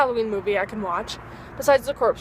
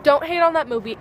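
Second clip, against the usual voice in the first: normal talking voice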